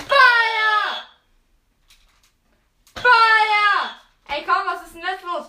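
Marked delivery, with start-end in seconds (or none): 0.0-3.8 loud game command shout. Real, not acted. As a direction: yell the fire order. Calling fire commands in game.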